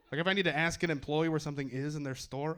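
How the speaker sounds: normal talking voice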